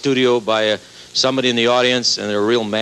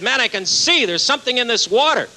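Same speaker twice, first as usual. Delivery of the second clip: much higher, astonished wonder; amazed joy; 11 semitones higher than usual. Acted. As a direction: marvel like a man who can suddenly see. Blind man cured by water.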